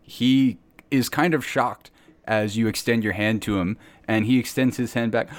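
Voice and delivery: ordinary talk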